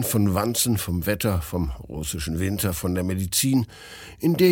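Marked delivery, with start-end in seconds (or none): none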